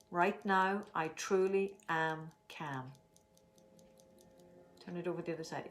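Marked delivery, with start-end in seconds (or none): 0.1-2.9 slow calm affirmation. Shown as a demonstration. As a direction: say it slowly, letting calm settle. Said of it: "let's make our statement".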